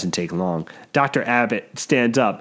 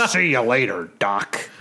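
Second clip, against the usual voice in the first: janitor voice; 4 semitones lower than usual. Acted. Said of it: "I love this janitor voice".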